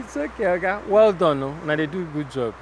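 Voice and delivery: soft friendly Pidgin thanks; Nigerian Pidgin. Real, not acted. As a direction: thank him in Naija Pidgin.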